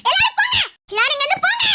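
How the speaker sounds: high-pitched furious order; distraught scream. Acted. Girl driving everyone away, distraught shouting.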